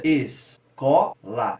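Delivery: "escola" slowly split, rising on the CO; es, CO, la with the middle syllable standing out; stressed syllable demo. Shown as a demonstration. Teacher showing where stress falls, slow split with stressed CO. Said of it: "no có ele dá uma ascendência".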